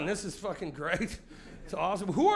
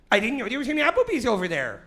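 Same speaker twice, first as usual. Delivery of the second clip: a bit higher, louder suburban chirp; repeated mock wife; 5 semitones higher, 6 dB louder than usual. Wife repeats her Applebee's remark in the minivan, louder chirpy mock. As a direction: say it again like a cheerful suburban mom. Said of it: "she said".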